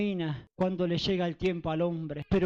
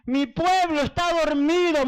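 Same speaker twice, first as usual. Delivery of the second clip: high, pained lament; retold tearful cry; 5 semitones higher, 4 dB louder than usual. Weeping over a sleeping people, retold tearful cry. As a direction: imitate a weeping plea in a raised voice. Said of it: "yo lloraba y le decía".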